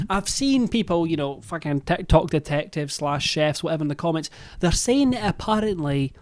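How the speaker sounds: ordinary delivery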